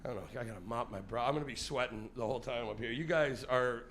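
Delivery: ordinary talk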